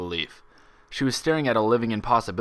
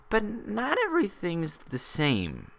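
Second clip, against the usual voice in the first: much higher, drawn-out and slow; slowly; 11 semitones higher, 3 dB quieter, slower than usual. A narrator's reading. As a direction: let each word hang. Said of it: "she added slowly".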